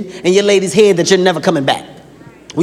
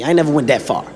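ordinary delivery

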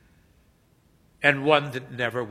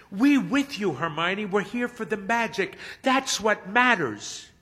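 supportive fan; 8 semitones higher than usual. A narrator's reading. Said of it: "Jim Hero chimes in".

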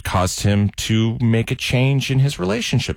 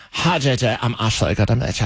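mock Valley Girl accent. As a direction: imitate a basic California girl accent. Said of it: "the most basic of California accents".

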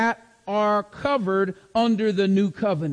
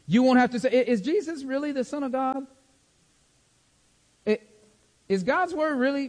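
imitating a doubter; 4 semitones higher than usual. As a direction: ask like an unsure seeker checking facts. Imitating a doubting believer's questions.

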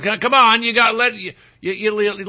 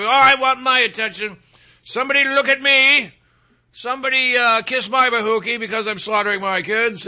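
mock attention seeker; 4 semitones higher than usual. Mocking attention-seeking copycat mother.

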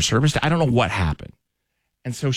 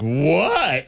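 surprised what; 6 semitones higher than usual. Retold or imitated. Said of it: "I literally said it like that".

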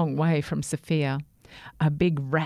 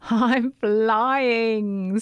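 high, loud joyful whoop; 4 semitones higher, 5 dB louder than usual. A narrator's reading. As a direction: whoop it as you soar. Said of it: "Sammy whooped as he rose".

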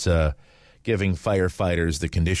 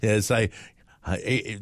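normal talking voice

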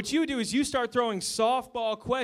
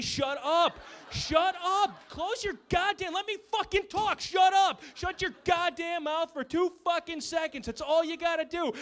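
pitched-up exasperated yelling; exploding yell; 6 semitones higher than usual. Retold or imitated. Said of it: "we finally snap and go".

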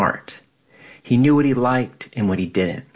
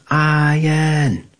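higher, drawn-out imitation of a call; mock shouted name; 7 semitones higher, 3 dB louder than usual. Twin brother answering who was ugly, mock shouting. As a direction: imitate a brother calling out the name, lifted and held. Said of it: "he'd shout my name".

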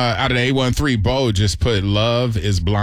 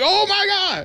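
high gasp then oh my God; scandalized and amused; shocked gasp. Real, not acted. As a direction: gasp in disbelief at the reveal. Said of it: "I'm like".

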